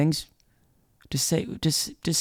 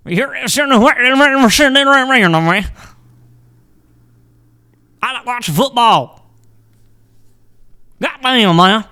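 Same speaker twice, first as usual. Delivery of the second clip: much higher and louder mock Southern accent; thick Southern accent; 8 semitones higher, 8 dB louder than usual. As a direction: lampoon thick Southerners, shouting it out. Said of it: "the thick Southerners".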